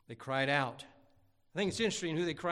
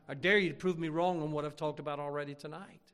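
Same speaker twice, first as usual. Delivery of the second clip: usual speaking voice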